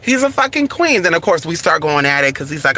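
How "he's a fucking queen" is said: mocking retort. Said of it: "I said".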